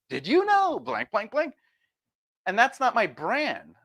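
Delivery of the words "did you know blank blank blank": much higher sing-song template line; mock clickbait hook. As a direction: parody the formula hook. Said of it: "Next video".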